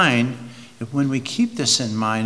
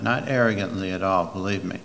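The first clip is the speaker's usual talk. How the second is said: ordinary talk, nothing marked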